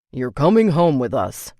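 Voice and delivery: flat announcement. A narrator's reading. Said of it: "her father announced flatly".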